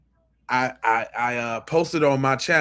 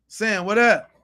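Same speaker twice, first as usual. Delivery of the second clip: usual speaking voice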